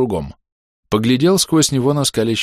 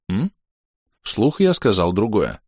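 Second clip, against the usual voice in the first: usual speaking voice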